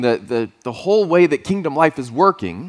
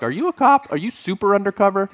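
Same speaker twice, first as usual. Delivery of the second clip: much higher-pitched quizzing; mock incredulous question; 7 semitones higher than usual. Driver questions a supposed officer in overalls, high and incredulous. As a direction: squeak it upward in playful disbelief.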